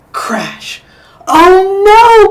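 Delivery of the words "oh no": high, loud cry of dismay; exclaimed. A narrator's reading. Goldie exclaiming after the chair crashes. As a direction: burst out in alarm. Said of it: "Goldie exclaimed".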